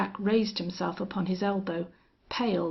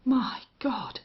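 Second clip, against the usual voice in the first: higher, whispered dread; horrified whisper; 4 semitones higher than usual. A narrator's reading. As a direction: whisper it as the truth sinks in. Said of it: "whispered the other".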